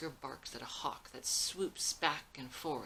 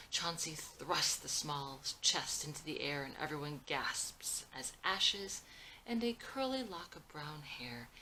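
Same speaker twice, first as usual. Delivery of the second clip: ordinary delivery, nothing marked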